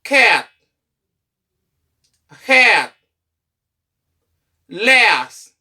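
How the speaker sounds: "Cat, Hat, Last" loud, each with a short, open A. Shown as a demonstration. Teacher returns class to short sound, short A. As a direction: model "Cat, Hat, Last" singly with long pauses, short vowel. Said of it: "nan short sound".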